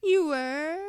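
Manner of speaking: bright, loud, high-pitched "You were?"; loud, high, gleeful disbelief; delighted surprise. Acted. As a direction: caught off guard and thrilled. Delighted surprise at reaper's answer.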